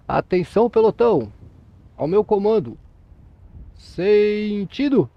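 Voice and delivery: loud barked parade command, last word long and drawn out; military drill command. Shown as a demonstration. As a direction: call it like a drill sergeant, stretching the final word. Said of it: "fica em posição de descansar".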